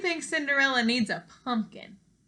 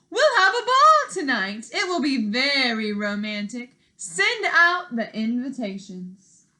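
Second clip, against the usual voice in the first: excited king; 2 semitones higher, 8 dB louder than usual. Acted. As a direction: announce it with royal glee. King decrees a ball.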